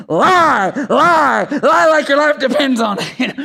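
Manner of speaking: high, loud devil urging him to lie; hammed-up shoulder devil egging him on; little devil voice. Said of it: "the devil over here is like".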